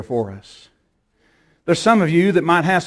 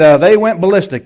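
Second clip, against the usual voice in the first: usual speaking voice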